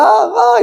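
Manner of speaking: very high mock shrieks; repeated pretend screams in falsetto; imitated group scream. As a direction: squeal like panicking passengers, volume held back. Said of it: "el grito de todos".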